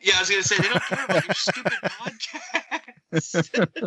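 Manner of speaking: laughing through the words. Real, not acted. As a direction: chuckle while saying it. Joking about sirens, laughing.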